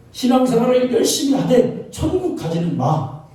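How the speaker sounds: high-pitched mock permission; devil's deceiving advice. Devil letting believers practise faith without heaven, high-pitched deceiving advice. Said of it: "이게 마귀가 우리를, 예, 속이는 거죠".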